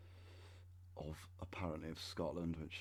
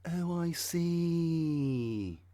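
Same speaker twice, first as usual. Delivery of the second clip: drawn-out, much higher and louder sarcastic oh I see; mock realization; 10 semitones higher, 8 dB louder, much slower than usual. Mocking the clan's land grabbing with a slow high sarcastic oh. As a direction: stretch it out like the penny just dropped, mocking.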